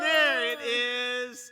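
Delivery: slow loud celebrating call; triumphant. Real, not acted. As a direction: drag it out like a win. Celebrating the correct guess.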